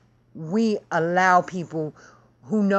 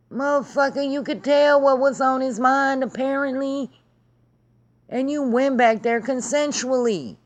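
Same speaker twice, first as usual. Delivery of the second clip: exasperated high rant; 6 semitones higher than usual. Real, not acted. Ranting to viewers about the guest, high-pitched exasperation. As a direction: pitch jumps way up in outraged disbelief.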